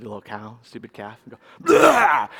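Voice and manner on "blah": loud, high startling yell; sudden shout. Retold or imitated. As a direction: bark it out to scare an animal. Yelling to spook a calf.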